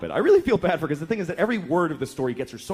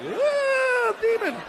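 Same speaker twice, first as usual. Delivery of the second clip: high shrieking yelp at the demon; comic terrified shriek; startled yelp; much slower than usual. Acted. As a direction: squeal like you just saw a monster.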